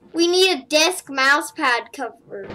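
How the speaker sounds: normal talking voice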